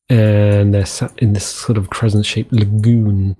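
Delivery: usual speaking voice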